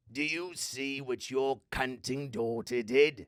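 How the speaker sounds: put-on slow, loud, demonic growl of a possessed child; possessed girl voice. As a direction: snarl it out like the Exorcist demon. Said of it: "the first impression that I did for my father from The Exorcist".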